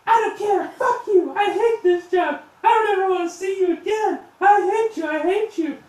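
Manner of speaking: shrieking high and very loud; high-pitched, full-force tantrum; furious screaming. Acted. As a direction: scream it like a daughter raging at her father. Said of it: "she's screaming at her father".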